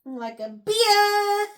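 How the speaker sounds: loud, high mock bawl; loud exaggeration. Friend answering what he does in the story, loud hammed-up shout. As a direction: belt it out, drawing the last word long.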